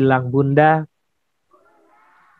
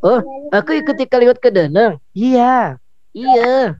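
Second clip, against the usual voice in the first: puppet voice; 4 semitones higher than usual. Acted. Puppet Odi answering host.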